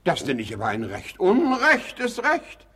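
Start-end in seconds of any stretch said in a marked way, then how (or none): 1.2-2.7 stretched out, loudly indignant; indignant outburst. Acted. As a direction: spit out the injustice. Husband outraged at inheritance law.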